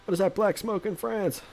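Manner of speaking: high, silly voice for reading chat; weird voice. Pretend. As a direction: read it out high and goofy. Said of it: "say what you say in a, a weird voice".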